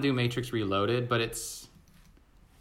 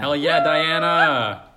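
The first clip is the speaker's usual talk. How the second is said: excited; 3 semitones higher, 8 dB louder, much slower than usual. Real, not acted. Cheering a listener.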